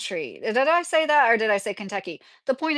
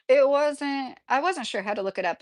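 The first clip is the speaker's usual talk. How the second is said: ordinary delivery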